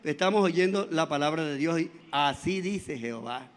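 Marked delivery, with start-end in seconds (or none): 2.1-3.5 Así dice Jehová in a low, hushed, far-off proclamation; solemn prophet voice. Shown as a demonstration. Preacher quoting the prophets to his church, low and hushed. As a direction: intone it like an ancient prophet. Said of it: "Esos términos los usaban".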